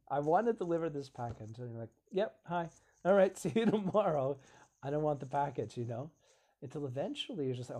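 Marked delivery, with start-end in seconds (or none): 0.1-1.6 loud, insistent call at the door; loud mock delivery guy; pushy delivery man. 2.1-5.8 loud, high, laughing dismissal; mock-cheerful refusal, much higher and louder; laughing brush-off. Acted brush-off at the door.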